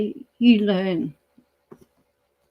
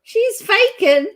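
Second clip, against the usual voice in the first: high, loud, slow, incredulous; can't believe it, question it; surprised; 12 semitones higher, 5 dB louder, slower than usual. Real, not acted. Host mishearing a guest, surprised.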